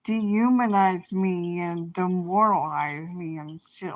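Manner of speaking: ordinary talk, nothing marked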